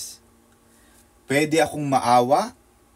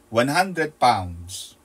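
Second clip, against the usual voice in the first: drawn out; 4 semitones lower, much slower than usual. Retold or imitated. Boss pays out wages.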